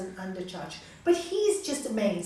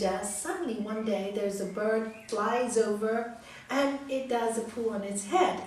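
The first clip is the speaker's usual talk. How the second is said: plain reading voice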